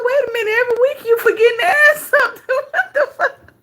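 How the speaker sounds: high, fast, laughing call-out; laughing disbelief. Real, not acted. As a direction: crack up while questioning her. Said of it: "I said".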